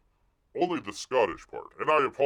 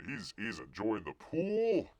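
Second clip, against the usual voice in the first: usual speaking voice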